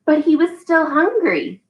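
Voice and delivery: slow, drawn-out storybook reading; patient read-aloud for a toddler; reading to a child. Acted. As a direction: leave pauses for the child to fill in. Reads The Very Hungry Caterpillar.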